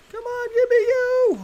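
very high, playful falsetto; squeaky put-on voice. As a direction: plead in a tiny high squeak. Squeaks at something in the game.

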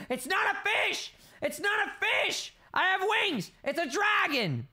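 excited yelling. Pretend. Player realising the change is dragon, yelling excitedly. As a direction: shout the discovery with mock alarm.

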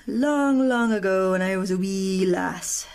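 playful Scottish lilt, drawn out; Scottish accent. Pretend. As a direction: slip into a put-on Scottish accent, slowly. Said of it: "I don't know where the Scottish accent came from".

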